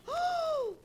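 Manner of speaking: sharp high gasp of alarm; sudden startled intake of breath; shocked gasp. Acted. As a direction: gasp at the bad news. Shocked by the newspaper.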